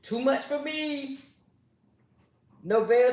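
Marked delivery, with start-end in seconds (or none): none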